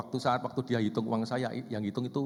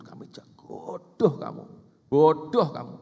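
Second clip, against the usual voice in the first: slow, then louder, hammed-up insult; furious yell; 4 semitones higher, 9 dB louder, slower than usual. Husband yelling insults at his wife, furious.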